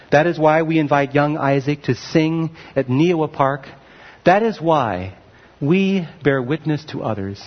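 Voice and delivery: ordinary talk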